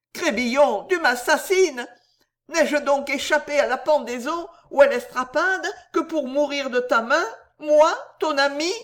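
plaintif. A narrator's reading. Ivrogne éploré supplie son ami.

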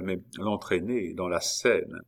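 ordinary delivery, nothing marked

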